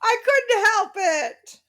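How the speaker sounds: high, slow, giggling apology; laughing excuse. Real, not acted. Excusing a joke to the co-hosts, laughing. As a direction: confess through a giggle.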